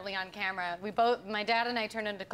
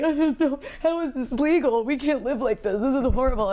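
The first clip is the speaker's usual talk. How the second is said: louder mock-tearful protest; overwhelmed crying; 3 semitones higher, 9 dB louder than usual. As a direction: imitate a friend falling apart in distress. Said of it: "so overwhelmed that she had to pull the car over and was crying".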